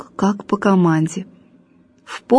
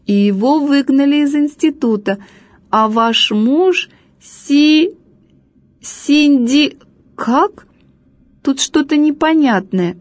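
raised, halting puzzled reading; 5 semitones higher than usual. A narrator's reading. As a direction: stumble over the strange name, baffled.

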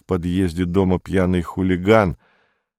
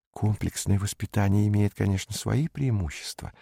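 muttering; 8 dB quieter than usual. A narrator's reading. Beatrice goes on about co-education, muttering. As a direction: keep mumbling softly to yourself. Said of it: "продолжала бормотать Беатриса".

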